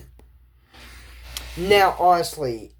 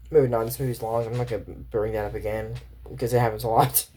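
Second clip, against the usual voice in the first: ordinary talk, nothing marked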